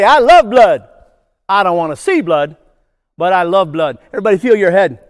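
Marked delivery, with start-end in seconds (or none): 0.0-0.9 loud, gleeful call; enthusiastic call. Shown as a demonstration. Presenter calling out a silly answer, loud. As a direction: belt it out with mock glee. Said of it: "Everybody say".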